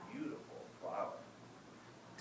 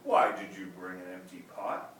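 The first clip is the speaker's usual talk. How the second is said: louder stern question; a ruler's firm demand; emperor asks; 2 semitones lower, 9 dB louder than usual. Acted. Emperor asks Ping about the empty pot, loud and firm. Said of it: "The emperor asked him".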